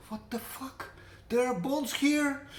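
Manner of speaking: retelling his own shocked reaction; shocked outburst. Retold or imitated. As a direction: blurt it out in disbelief.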